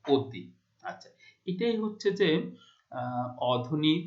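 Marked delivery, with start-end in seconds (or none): 0.1-0.4 অতি said "O-ti", first vowel rounded; closed o vowel. Shown as a demonstration. Teacher answering the class question, অতি as closed "O-ti". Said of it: "ঠিক উচ্চারণটা কি হবে?".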